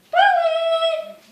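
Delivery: high call sent far across the park. Acted. Big sister searching the park, calling out far and high. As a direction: call out to someone far away.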